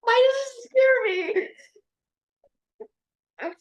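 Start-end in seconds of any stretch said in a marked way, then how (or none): 0.0-1.5 mock scared whisper. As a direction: sound lost and scared of herself. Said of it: "She's like".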